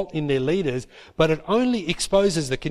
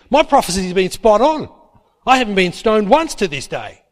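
put-on indignant older sister; 5 semitones higher, 4 dB louder than usual. Miriam and Aaron confronting Moses.

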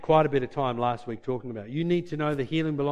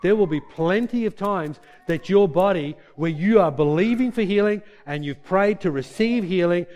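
normal talking voice

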